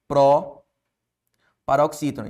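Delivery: "pró-paroxítona" slow, with pró held apart; teacher slowly sounding out "pró-paroxítona"; drawn-out key term. Shown as a demonstration. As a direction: stretch "pró", pause, then finish "pró-paroxítona".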